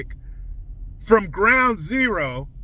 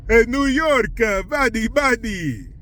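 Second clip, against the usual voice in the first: high, hammed-up New York tough-guy voice; "Hey, New York, buddy" in a put-on New York twang; New York accent; 5 semitones higher than usual. Mock New York accent greeting the city. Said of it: "I should use the new New York accent".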